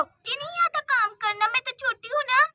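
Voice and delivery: put-on small child. Niece begging the bully cousin, in a high childish plea. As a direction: plead like a tiny kid who can't work.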